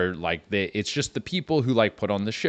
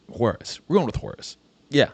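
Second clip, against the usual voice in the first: normal talking voice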